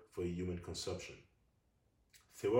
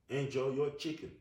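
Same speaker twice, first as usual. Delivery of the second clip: excited; 7 semitones higher, 6 dB louder than usual. Real, not acted. Excited pitch jump.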